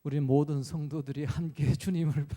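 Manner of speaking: imitated church prayer, low and measured; mock-solemn worship-leader intonation; formal public prayer. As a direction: recite a rote congregational prayer in a low tone. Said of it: "그런 기도는 잘했어요".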